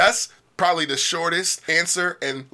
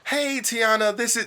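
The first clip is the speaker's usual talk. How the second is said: warm greeting, name stressed; 8 semitones higher than usual. Shown as a demonstration. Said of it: "that right there".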